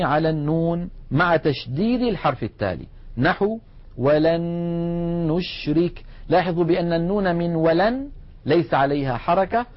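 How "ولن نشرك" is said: ولن نشرك recited with both noons fused into one long, held nasal n; merged doubled noon. Shown as a demonstration. Teacher showing students an example of noon merging, merged held noon in ولن نشرك. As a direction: hold the joined n in ولن نشرك before releasing it. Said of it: "النون من نشرك عليها شدة".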